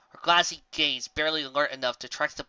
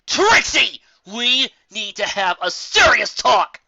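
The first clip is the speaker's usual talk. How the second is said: higher, loud and slow, seething; furious demand; 8 semitones higher, 11 dB louder, slower than usual. Acted. Raindrops bursting into Trixie's office, furious. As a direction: hold back the fury barely. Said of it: "eyes full of murderous fury".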